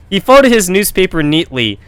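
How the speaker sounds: usual speaking voice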